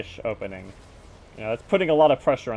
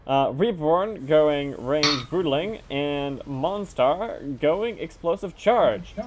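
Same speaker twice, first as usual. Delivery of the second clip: drawn-out, higher announcer delivery; announcer roll call; 4 semitones higher, 4 dB louder, slower than usual. Acted. Caster introduces the bottom-left team, announcer style. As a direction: stretch each player name like a ring announcer.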